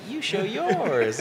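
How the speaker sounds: drawn-out high taunting dare; imitating her. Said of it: "her just being like".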